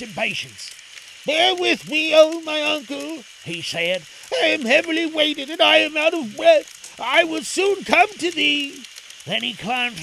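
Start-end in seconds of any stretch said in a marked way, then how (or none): 1.2-3.2 put-on high, loud boy's plea; raised, imploring character voice; pleading Aladdin. Weary Aladdin pleads with uncle. 4.3-8.8 put-on high, loud boy's plea; raised, imploring character voice; pleading Aladdin. Weary Aladdin calls up to uncle.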